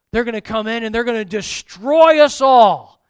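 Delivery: loud, panicky warning; alarmed outburst. Acted. Frightened soldier warning his tent mate, loud alarm. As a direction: cry out that doom is coming. Soldier interprets dream fearfully.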